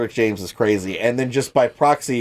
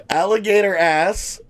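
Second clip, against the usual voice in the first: ordinary talk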